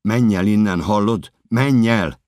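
high, loud urgent yell; desperate shout. Acted. Narrator warning the woman away, desperate shout. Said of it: "kiabálok".

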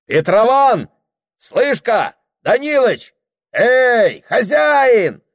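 very high, loud calls; hailing shout. Acted. Guests hailing their host at the feast, shouting. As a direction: holler for someone across a noisy table.

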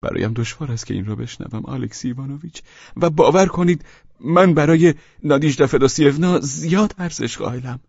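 high, blushing plea; hurt and flushed; flustered; embarrassed. Acted. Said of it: "از نو قرمز شد".

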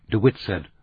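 usual speaking voice

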